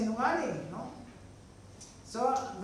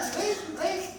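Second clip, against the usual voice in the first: high mock cry for help, a bit less loud; mock scream; 12 semitones higher, 5 dB louder than usual. Preacher repeating Potiphar's wife's false cry, high mock scream. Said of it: "Sabi niya".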